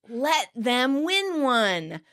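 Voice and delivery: very slow, word by word; insistent repeat. Retold or imitated. Chandler repeating his plea to Monica, slow and insistent. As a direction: spell it out slowly, each word separate. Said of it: "So Chandler repeats".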